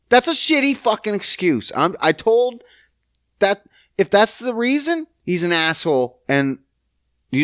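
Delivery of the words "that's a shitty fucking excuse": ordinary talk, nothing marked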